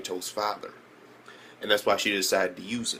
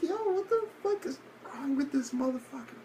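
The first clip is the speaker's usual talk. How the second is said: incredulous; 5 dB quieter than usual. Retold or imitated. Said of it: "I'm like".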